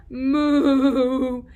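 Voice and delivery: playful moo; cow moo. Shown as a demonstration. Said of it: "The cow says what?".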